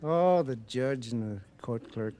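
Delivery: ordinary talk, nothing marked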